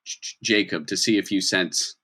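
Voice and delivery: usual speaking voice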